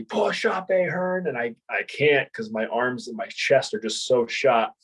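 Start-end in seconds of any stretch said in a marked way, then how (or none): 0.0-1.3 high mock scream without full volume; retold scream. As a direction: imitate a drill instructor screaming an order. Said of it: "he's screaming at me".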